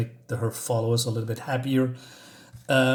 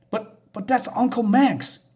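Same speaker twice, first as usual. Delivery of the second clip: put-on higher, slow voice for a child; lightly voiced girl, stammering an objection; a hint of a confused child; protesting girl; 8 semitones higher, slower than usual.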